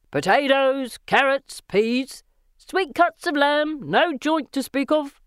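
high, drawn-out market calls; street vendor's cry. A narrator's reading. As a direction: hawk your wares in singsong shouts. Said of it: "scream out from their wagons".

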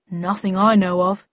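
mildly regretful reply; understated sadness; sad. A narrator's reading. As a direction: admit it with a touch of sorrow. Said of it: "he replied sadly".

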